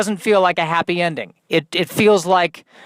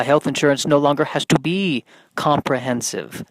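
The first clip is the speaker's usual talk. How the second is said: ordinary talk, nothing marked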